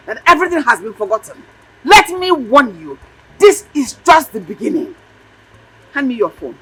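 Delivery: put-on irate mother telling off her child; angry threatening scolding. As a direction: lay down a furious warning.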